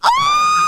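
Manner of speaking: high, loud flustered squeal into laughter; shrieking laugh. Real, not acted. Squealing at the flirty scene, then laughing. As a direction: squeal with embarrassed delight.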